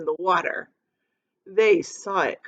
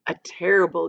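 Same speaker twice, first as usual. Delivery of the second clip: usual speaking voice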